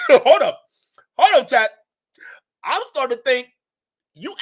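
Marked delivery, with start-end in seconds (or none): 0.1-1.7 laughing through it, higher and louder; real laughing hold up. Real, not acted. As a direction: break into laughter and call for a pause. Laughing interjection at chat.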